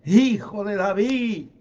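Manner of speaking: drawn-out desperate call; straining to shout. Acted. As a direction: cry out so the distant one hears. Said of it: "Se esforzaban más gritando".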